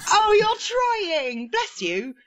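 high, drawn-out mock-touched coo; patronising pity. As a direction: coo with exaggerated sympathy, very slow at first. Patronising pity for keen worker.

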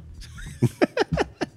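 high, airless burst of laughter; wheezy laugh; breathy laugh. Real, not acted. Laughing at the mixup.

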